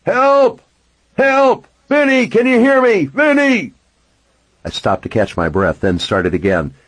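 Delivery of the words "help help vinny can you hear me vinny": high, loud desperate shouting; yelling for help. A narrator's reading. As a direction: shout for help with all you've got. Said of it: "I started yelling".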